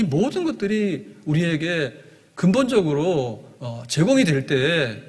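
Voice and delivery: fervent sermon peak, words stretched out; preaching at full force. Acted. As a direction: drive it home from the pulpit. Pastor climaxes on salvation.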